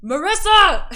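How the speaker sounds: loud, high holler; yelling. Acted. Innkeeper calling over his shoulder to the back room, yelling. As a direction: yell it to someone in another room. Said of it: "and yells".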